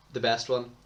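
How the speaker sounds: ordinary talk, nothing marked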